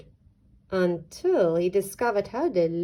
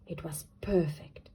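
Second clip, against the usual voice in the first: hushed whisper, no voice; 3 semitones lower, 7 dB quieter than usual. A narrator's reading. Narrating to children, whispered delight. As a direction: breathe it like a secret. Narrator savouring duck's perfect nest.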